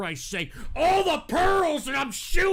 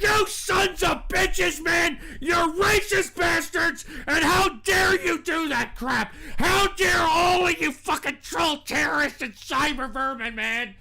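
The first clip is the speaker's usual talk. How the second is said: furious rant; 2 semitones higher than usual. Real, not acted. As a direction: rage at the trolls, voice cracking. Host rants at trolls.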